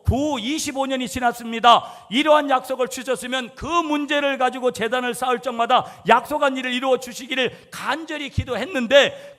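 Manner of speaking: loud, high, insistent sermon delivery; preaching at full force. Acted. Pastor exhorting his congregation, preaching at full force. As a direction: drive it home to the pews.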